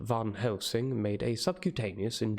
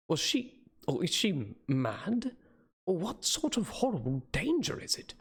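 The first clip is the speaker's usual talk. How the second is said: much higher, bewildered and frightened; alarmed questioning; 8 semitones higher than usual. Acted. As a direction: voice leaps up in alarm. Narrator bewildered outburst.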